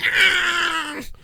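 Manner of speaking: mock monster roar, way up high; Godzilla roar. Imitating Godzilla's roar for the stream. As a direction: roar like a giant movie monster. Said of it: "Godzilla's like".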